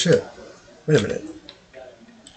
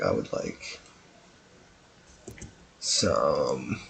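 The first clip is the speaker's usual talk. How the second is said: deep voice; 12 semitones lower, 11 dB quieter, much slower than usual. Pretend. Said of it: "second request, low voice".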